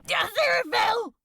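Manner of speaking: high, loud, fast panicked scream; terrified scream. Acted. Crowley screaming the angel's name at his torturer, terrified. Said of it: "he screamed".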